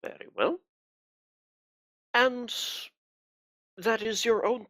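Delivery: a cold, pious interrogator; cold pious accusing. Acted. Inquisitor presses about ring.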